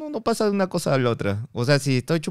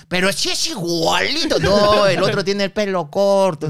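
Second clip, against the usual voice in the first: higher, drawn-out mock insistence; insistent bickering; 5 semitones higher, slower than usual. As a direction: imitate relatives arguing over a lookalike. Voices family arguing over his likeness. (discusión familiar imitada, con voz aguda)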